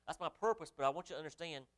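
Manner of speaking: ordinary delivery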